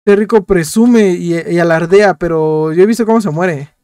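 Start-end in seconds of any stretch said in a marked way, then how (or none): none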